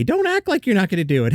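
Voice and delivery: high, teasing call-out; mock scolding. Host calling out the actresses, teasing. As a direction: wag a finger at them, pitched high. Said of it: "I'll chime in".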